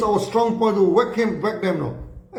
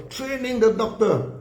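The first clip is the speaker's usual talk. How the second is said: ordinary talk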